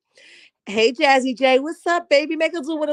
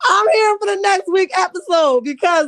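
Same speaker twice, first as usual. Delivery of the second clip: tearful laughing; 7 semitones higher than usual. Real, not acted. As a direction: laugh while saying it. Laughing through words.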